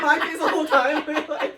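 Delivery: loud, high words through a laugh. Real, not acted. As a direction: burst out laughing mid-sentence. Laughing about her own face.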